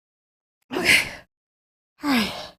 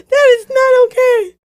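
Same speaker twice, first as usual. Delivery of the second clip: higher, teary-edged protest; scared; 7 semitones higher, 4 dB louder than usual. Real, not acted. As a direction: insist shakily it's not okay. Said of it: "So freaked out".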